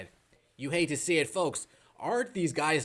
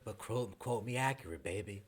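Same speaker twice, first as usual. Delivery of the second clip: quiet, syrupy mock warning; lightly imitating a sly sportscaster; soft passive-aggressive Stephen A; 6 semitones lower, 8 dB quieter than usual. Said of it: "passive-aggressive self".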